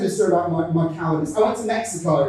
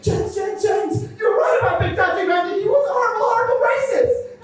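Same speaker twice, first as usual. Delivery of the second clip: high, urgent mock alarm; mock panic; 10 semitones higher than usual. Running back to warn James about Andy, high mock panic. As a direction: blurt it out high, acting panicked.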